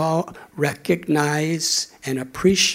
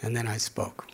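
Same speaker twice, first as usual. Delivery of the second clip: usual speaking voice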